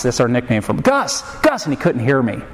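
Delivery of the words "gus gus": high-pitched retold calls; repeated name, pitched up but not truly shouted; urgent calling. Dad calling a son who can't hear him, high mock call.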